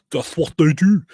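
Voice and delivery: a silly put-on voice repeating it for laughs; mock put-on voice. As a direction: ham up the catchphrase in a silly voice.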